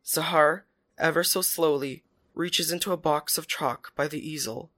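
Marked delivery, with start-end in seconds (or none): none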